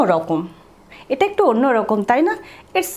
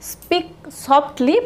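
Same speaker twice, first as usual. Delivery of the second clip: slow model phrase; 4 semitones higher, much slower than usual. Shown as a demonstration. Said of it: "গলার স্বরকে আমরা নামিয়ে".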